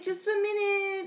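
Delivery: high chirpy "just a minute"; sing-song polite call. Retold or imitated. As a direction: call out politely through a locked door. Polite call through bathroom door.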